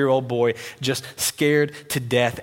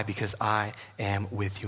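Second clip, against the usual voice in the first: fatherly comfort; 5 semitones lower, 9 dB quieter than usual. Acted. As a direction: comfort a scared child gently. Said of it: "fatherly affection in the voice of God".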